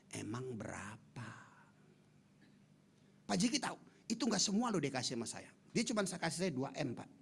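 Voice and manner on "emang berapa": slow whispered question; curious whisper. Retold or imitated. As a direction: ask it quietly and slowly. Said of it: "saya iseng nanya". (bisikan penasaran)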